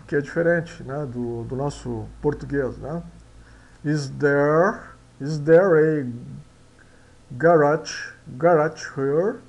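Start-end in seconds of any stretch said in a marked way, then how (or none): 3.8-6.1 slow careful English, rolled r in "there"; English pronunciation demo. Shown as a demonstration. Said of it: "Tem que enrolar a língua". 7.3-9.4 "garage" said garatch, louder; pronunciation demo. Shown as a demonstration. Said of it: "Tem que enrolar a língua".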